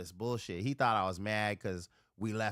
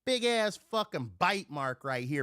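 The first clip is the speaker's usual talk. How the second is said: normal talking voice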